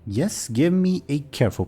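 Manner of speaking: ordinary delivery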